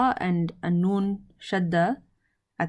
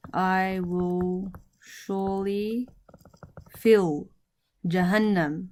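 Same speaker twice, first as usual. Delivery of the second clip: very slow, stretched words with pauses; word-by-word dictation; much slower than usual. Shown as a demonstration. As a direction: draw each word out for learners. Teacher repeats translation word by word.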